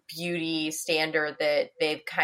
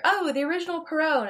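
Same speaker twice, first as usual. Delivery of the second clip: high, mock know-it-all voice; mocking imitation; 10 semitones higher, faster than usual. Librarian imitating people citing Cinderella's sources, mocking. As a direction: parrot the purists in a raised voice. Mimicking people who cite originals.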